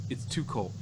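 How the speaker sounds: usual speaking voice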